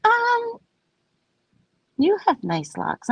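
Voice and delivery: high, hesitant mock Um; a reluctant stranger forcing praise; grudging compliment. Locals grudgingly complimenting her locks, high and hesitant. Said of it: "And they're like".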